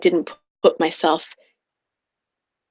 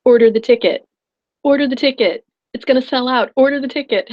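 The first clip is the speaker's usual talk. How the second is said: louder, higher, urgent repetition; insistent inner voice; 5 semitones higher, 4 dB louder than usual. Retold or imitated. Her intuition urging her, louder and higher, to buy. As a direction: nag yourself to order the ticket. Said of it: "my intuition was like".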